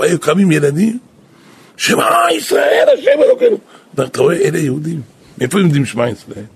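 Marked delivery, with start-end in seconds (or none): 1.8-3.6 put-on children shouting. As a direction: shout the prayer back as children. Children answering, crying out. (צעקת ילדים גבוהה)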